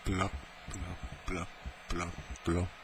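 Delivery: very soft imitated blops; blop noise. Correcting the imitated noise for listeners, very soft blops. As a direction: drop tiny hushed blops, one by one. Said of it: "it's more of a blop".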